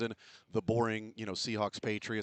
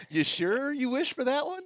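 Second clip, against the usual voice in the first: mock warning; 7 dB louder than usual. Mocking warning to fans.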